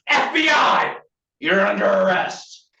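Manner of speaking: high, loud shouted command; arrest command. Shown as a demonstration. FBI trainee arresting someone, shouted command. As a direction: shout it to freeze a suspect.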